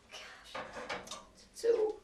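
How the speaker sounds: anxious breathy whisper to herself; hushed fretting whisper; worried whisper. Acted. Worried daughter talking to herself.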